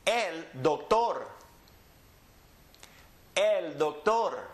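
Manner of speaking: slow El doctor, el dok-TOR; Spanish pronunciation. Shown as a demonstration. Teacher models for repeating, El doctor slowly stressed on TOR. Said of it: "Repeat after me".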